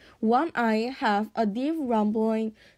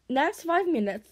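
plain reading voice

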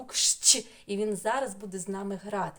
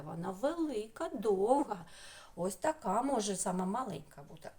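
ordinary delivery

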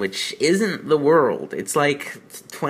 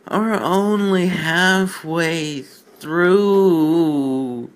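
slow despairing drag; 5 semitones higher, 4 dB louder, much slower than usual. Real, not acted. Host dreading the rest of the bad fan fiction, drawn-out despair. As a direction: stretch it out, slower, higher and louder.

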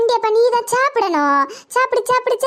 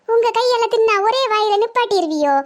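ordinary talk

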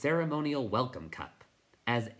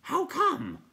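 high, loud hooting question; puzzled night hob; 6 dB louder than usual. Acted.

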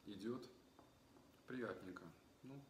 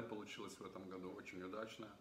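speaking through fingers; partly covered mouth; hand near mouth; 4 dB quieter than usual. Real, not acted. Seen 1: fist at mouth to 0.8s, then at eye, back at 2.7s.

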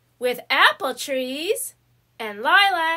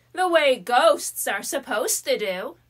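plain reading voice

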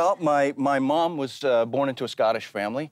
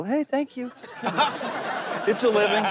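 high squeaky thanks; 11 semitones higher than usual. Retold or imitated.

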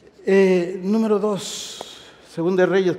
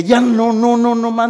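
fed-up refusal; 6 semitones higher, 6 dB louder, slower than usual. Acted. Y dije.

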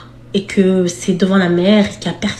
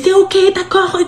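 scared giving in; 10 semitones higher than usual. Retold or imitated. Crying, giving in.

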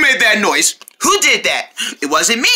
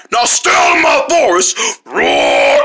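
angry bear roar; 7 semitones lower than usual. Acted. Bear drives them off.

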